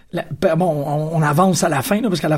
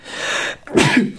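a real sneeze into the mic; sneezing; 6 semitones higher than usual. Real, not acted. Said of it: "je vais éternuer, ça va être très drôle".

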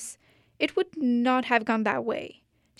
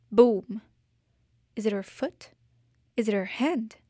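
ordinary delivery, nothing marked

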